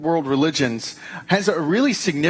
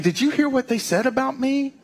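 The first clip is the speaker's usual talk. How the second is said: much higher-pitched playful mock gossip; gossiping zoo animal; 10 semitones higher than usual. Zoo chimp gossiping to another, high mock-gossip voice. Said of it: "a chimpanzee talking to another one, saying".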